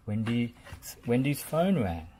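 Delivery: usual speaking voice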